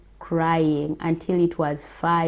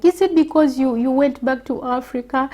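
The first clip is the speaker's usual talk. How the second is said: high, loud, pleading friend voice; worried friend's question; 7 semitones higher, 6 dB louder, faster than usual. Retold or imitated. Friend pressing about the Africa trip, high loud worried voice. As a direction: pitch it way up, anxious and prying. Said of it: "And she was like".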